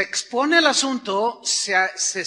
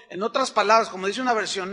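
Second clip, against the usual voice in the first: ordinary talk, nothing marked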